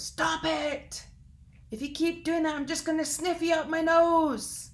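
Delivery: high, loud furious elk bellow; angry elk shout. Acted. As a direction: yell it down at the tiny bird.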